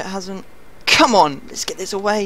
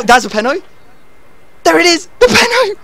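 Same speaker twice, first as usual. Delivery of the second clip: higher and louder with each line; excited shout; 12 semitones higher, 11 dB louder, faster than usual. Real, not acted. Player crowing over a penalty, louder and higher. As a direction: crow it out triumphantly.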